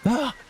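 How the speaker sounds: short high "ah"; mock startled yelp. Player as a character just appears, mock startled. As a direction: give a sudden high yelp.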